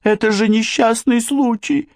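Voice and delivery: very high insistence; frightened babble. A narrator's reading. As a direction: insist fearfully in a squeaking pitch. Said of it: "пролепетала Джанет". (испуганно, очень высоким голосом)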